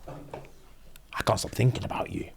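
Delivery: slow, hushed whisper; whispered confession. Retold or imitated. Mister Gage confessing his feelings to Demi, whispering. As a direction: breathe it like a secret.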